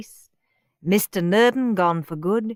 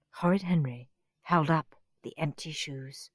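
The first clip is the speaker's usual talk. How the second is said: plain reading voice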